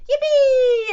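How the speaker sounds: high, loud, drawn-out cheer; whooping high with delight; gleeful yell. A narrator's reading. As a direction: yell it out for the whole crowd. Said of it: "everybody yelled".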